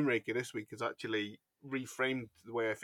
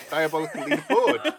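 louder, with "leaderboard" rising showily at the end; mimicked swoop on LEADERBOARD; 3 semitones higher, 7 dB louder than usual. Co-host mimicking how the host said leaderboard earlier, exaggerated rise. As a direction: imitate a showy rise on "leaderboard". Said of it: "I like the way you said leaderboard".

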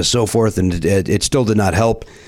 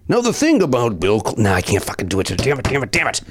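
ordinary delivery

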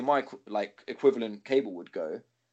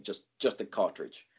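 usual speaking voice

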